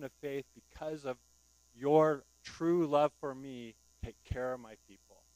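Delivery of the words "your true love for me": loud and slow, drawn out; preacher's slow loud emphasis; emphatic. Acted. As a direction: lean hard on every word.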